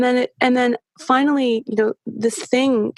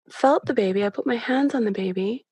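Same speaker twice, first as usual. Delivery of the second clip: ordinary delivery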